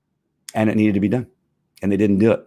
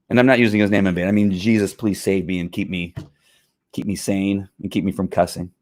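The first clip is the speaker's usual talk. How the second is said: normal talking voice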